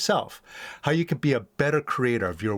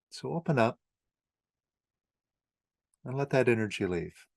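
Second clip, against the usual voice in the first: lower, softer meditation guide with long pauses; guided meditation; 6 semitones lower, 4 dB quieter than usual. Acted. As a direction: gently lead the listener through letting go, leaving long silences.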